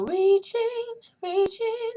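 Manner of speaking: "Reaching" sung high in head tone. Shown as a demonstration. Coach showing the head tone on reaching. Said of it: "But then when you go".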